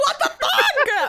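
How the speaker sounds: loud laughing disbelief; laughing shout. Real, not acted. Reacting to the snake confession.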